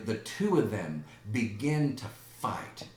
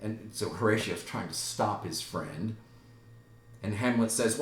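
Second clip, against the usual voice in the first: usual speaking voice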